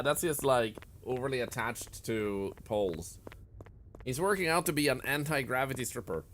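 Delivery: ordinary talk, nothing marked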